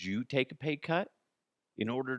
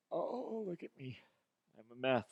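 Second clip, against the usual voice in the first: mock fretful boss; 3 semitones higher, 4 dB quieter than usual. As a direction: fuss over yourself in mock distress. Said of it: "him rehearsing that whole thing".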